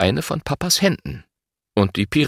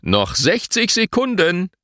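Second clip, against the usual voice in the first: higher-pitched time call; referee's call, pitch raised; 8 semitones higher than usual. A narrator's reading. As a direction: announce the time as a mild shout. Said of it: "Papa ruft".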